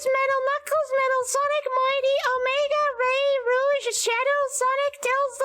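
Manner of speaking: very high, cutesy uwu voice; squeaky, cutesy anime baby talk. Acted. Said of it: "to do my Uwu voice".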